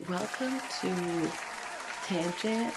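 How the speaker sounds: ordinary delivery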